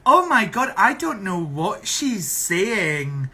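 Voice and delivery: high, loud mock bafflement, slowing down; hammed-up exasperated quote, dragged out at the end; mocking complainers. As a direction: imitate people exaggerating their confusion. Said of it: "People always go".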